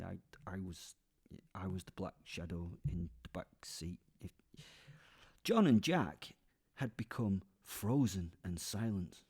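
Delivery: ordinary delivery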